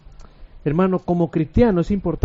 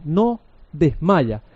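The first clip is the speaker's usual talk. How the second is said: ordinary delivery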